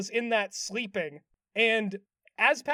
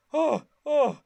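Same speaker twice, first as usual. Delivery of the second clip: loud, higher mock pants; imitated panting; 5 semitones higher, 6 dB louder than usual. Patrick worn out from all the enjoyment, acted panting. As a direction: pant in two exaggerated puffs. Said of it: "he's, like, panting".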